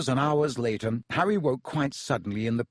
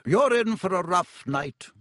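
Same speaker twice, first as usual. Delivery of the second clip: higher-pitched matron's warning; stern warning; 6 semitones higher, 4 dB louder than usual. A narrator's reading. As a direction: warn the patient with brisk authority. Nurse warns her injured patient.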